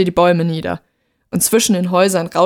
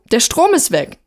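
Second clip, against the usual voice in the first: higher, more pressing call through a door; insistent calling out; 5 semitones higher, 3 dB louder than usual. A narrator's reading. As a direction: repeat it insistently to someone behind a door. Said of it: "rief sie durch die geschlossene Tür".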